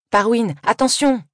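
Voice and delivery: high, slowed cry of alarm; a girl's sudden shouted warning; urgent warning shout. Acted. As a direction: call out to warn her sister. Warning sister of oncoming bike.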